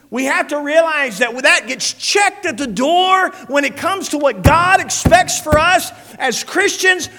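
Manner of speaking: loud, high, full-force preaching. Acted. Preacher proclaiming God's glory to the church, full-force preaching. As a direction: thunder it out to the congregation.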